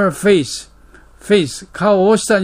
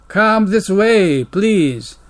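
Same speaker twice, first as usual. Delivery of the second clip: ordinary talk, nothing marked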